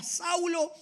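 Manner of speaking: high, loud calling voice of the Lord; voice from heaven. Acted. Jesus calling Saulo from heaven, high ringing call. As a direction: call out from the sky, booming. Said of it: "oyó una voz que le decía".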